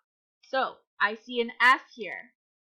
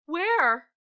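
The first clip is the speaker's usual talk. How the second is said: higher, louder anxious cry; very scared; 7 semitones higher, 6 dB louder than usual. Shown as a demonstration. Teacher repeating the bear's word, scared. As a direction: cry it out like a frightened cub. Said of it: "my voice matched how little brown bear was feeling".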